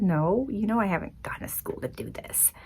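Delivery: whispered, sheepish confession; meek whispered reply. Retold or imitated. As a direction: admit it in a small whisper. Meek answer to God.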